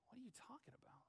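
baffled whispered question. Acted. Puzzled follower whispering about Jesus' strange teaching. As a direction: hiss it in disbelief.

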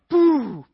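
loud popping sound effect; impact noise. Retold or imitated. Preacher mimics the shoulder popping loudly. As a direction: pop it out like a joint snapping.

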